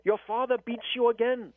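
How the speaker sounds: high-pitched imitated African accent. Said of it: "I'm gonna feign his African accent".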